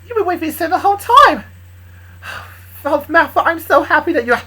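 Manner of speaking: high, loud, gushing delight; overjoyed, words tumbling out high; happily surprised. Acted. Woman on the phone hearing a love confession, happily surprised. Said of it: "she looked so happily surprised".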